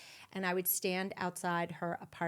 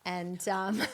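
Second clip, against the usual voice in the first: ordinary delivery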